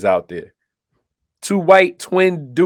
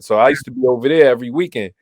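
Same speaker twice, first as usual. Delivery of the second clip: normal talking voice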